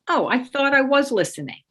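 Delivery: mild, blasé surprise; nonchalant correction. Shown as a demonstration. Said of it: "it's low key, nonchalant, blasé, casual".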